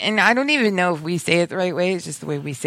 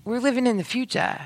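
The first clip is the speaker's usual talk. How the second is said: higher-pitched playful proclamation; mock futuristic proclamation; 7 semitones higher than usual. Co-host teasing about old name merchandise, mock proclamation.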